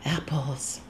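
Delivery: faint sleep-moan, each word trailing away; sleepy moaning. A narrator's reading. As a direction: moan it softly, fading to a whisper.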